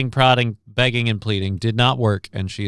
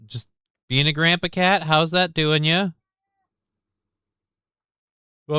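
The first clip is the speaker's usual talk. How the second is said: coaxing pet talk; 5 semitones higher than usual. Real, not acted. Coaxing the cat over.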